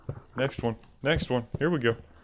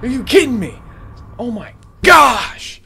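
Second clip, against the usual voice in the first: high, loud exasperated cry; outraged shouting; 11 semitones higher, 11 dB louder than usual. Real, not acted. Player caught while escaping, outraged shout. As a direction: yell in disbelief, stretching the last word. Outraged after being scared.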